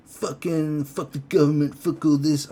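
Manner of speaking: high, loud sneering imitation of a lazy ranter; hammed-up slouching professor; slobby mock rant. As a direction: lounge back and spit out the swearing. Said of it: "slobbed out in this chair like this".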